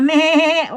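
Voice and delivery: mock bleat for the child; sheep bleat. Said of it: "Kapag ang mga tupa".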